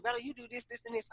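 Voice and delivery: very soft mimicry of a nag; imitated complaint. Partner listing faults in conversation, imitated softly. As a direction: murmur the list of complaints. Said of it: "and you like".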